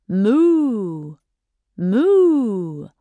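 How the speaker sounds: ordinary talk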